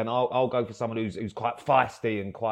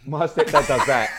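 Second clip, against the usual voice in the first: usual speaking voice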